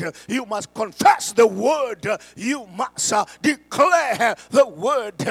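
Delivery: building from loud to shouted high preaching; preaching at full force. Real, not acted. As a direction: whip the church into declaring it.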